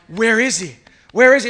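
panicked parent scanning the crowd; worried parent calling out for a lost son; frantic search. Retold or imitated.

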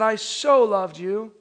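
slow with "so" lingering; stress on so. Retold or imitated. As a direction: speak God's words slowly. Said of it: "looked at each one of us... and said".

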